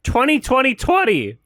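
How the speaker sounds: high, incredulous parrot of the chant; mocking echo. Streamer echoing the rapper's chant, mocking it high. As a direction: throw 'Twenty twenty twenty' back high and disbelieving.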